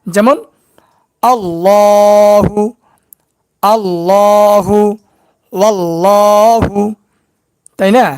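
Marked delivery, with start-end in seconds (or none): 1.2-6.8 আল্লাহু with a full, thick ল, drawn out; heavy lam recitation. Shown as a demonstration. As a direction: round and thicken the ল in আল্লাহু slowly. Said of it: "পুর বা মোটা করে পড়তে হবে".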